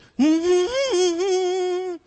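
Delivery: long, loud quavering squeal; little girl's acted whimper; high-pitched wordless whimper. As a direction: tremble out a high hum. Frightened girl's wordless answer.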